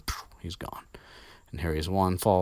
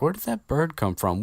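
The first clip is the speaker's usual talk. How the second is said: puzzled Ron; 10 semitones higher, 3 dB louder than usual. Retold or imitated. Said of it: "he's like".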